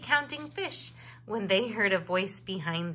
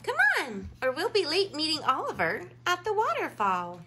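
hurrying sister bear; 7 semitones higher, faster than usual. A narrator's reading. Molly hurrying her brother.

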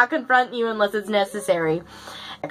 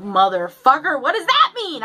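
scared; 5 semitones higher than usual. Retold or imitated. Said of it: "I was so scared".

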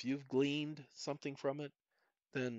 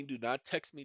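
ordinary talk, nothing marked

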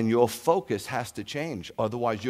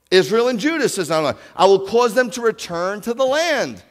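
higher, much louder proclaiming read; preaching scripture; 9 semitones higher, 10 dB louder than usual. Acted. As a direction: declare God's word to the hall. Reads scripture with force.

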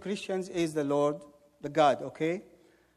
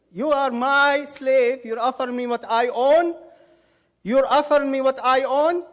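loud, higher-pitched acted outrage; indignant rebuke; 5 semitones higher, 6 dB louder than usual. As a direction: rebuke him as an offended master. Acting out Jesus rebuking the devil.

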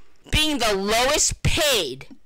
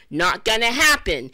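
normal talking voice

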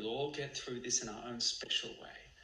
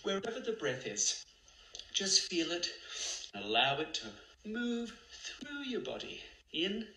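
guided meditation; 5 semitones higher than usual. Acted. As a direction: lead them gently through the breath.

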